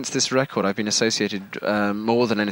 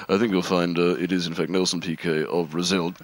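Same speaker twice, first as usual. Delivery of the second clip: lightly voiced, lower dry correction; James Hunt; 7 semitones lower than usual. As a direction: cut in with a low, dry aside. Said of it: "James Hunt interrupts".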